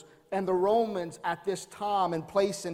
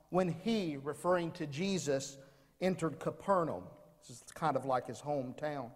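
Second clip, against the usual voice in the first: soft, low scripture reading; 4 semitones lower, 4 dB quieter than usual. Acted. Pastor reading Matthew to the congregation, soft and hushed. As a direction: read the verse quietly to the congregation.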